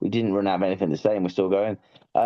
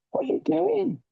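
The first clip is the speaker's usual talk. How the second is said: mock exasperated cry. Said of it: "It's like".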